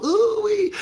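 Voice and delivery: high gleeful squeal of delight; giddy girlfriend squeal; excited squeal. Acted. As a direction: squeal it like you just met someone. Girl gushing about meeting someone.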